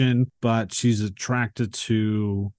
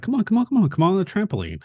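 higher, mock eager kid pleading; excited kid; 9 semitones higher, much faster than usual. [name] urging Susan onto the trampoline, higher mock eager kid. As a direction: chirp it up like an overeager child.

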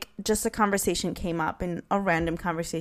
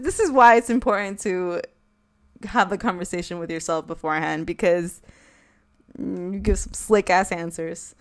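ordinary talk, nothing marked

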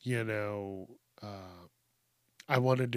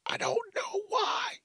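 very high, squealed falsetto whine; mock wail. Aging podcaster lamenting that everything hurts, mock wail. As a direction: cry it out in a hammed-up squeak.

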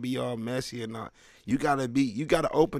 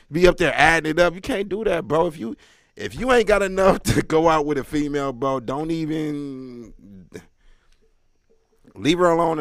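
loud and emphatic; loud rant; 4 semitones higher, 9 dB louder than usual. Acted. Comic scolding the crowd, loud. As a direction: call them out loudly.

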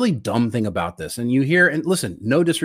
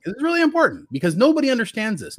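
emphatic; 13 semitones higher, 3 dB louder than usual. Real, not acted. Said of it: "hold on a sec".

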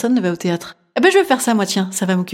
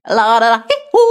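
cris chantonnés; 3 dB louder than usual. Acted. Parodie d'échauffement de théâtre de rue. (petit chant et cri aigu)

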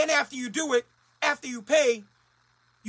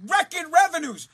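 mock cheer; 6 semitones higher, 7 dB louder, slower than usual. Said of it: "the Mass State Lottery is like".

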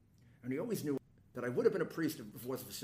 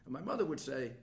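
ordinary talk

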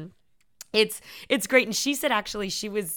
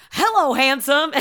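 loud, higher-pitched mock sweet talk; 6 semitones higher, 8 dB louder than usual. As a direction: lay on the flattery, big and hammy. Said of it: "sweet talking him".

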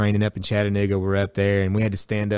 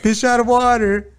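mocking coworker; 13 semitones higher, 4 dB louder, slower than usual. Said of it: "And then it's like".

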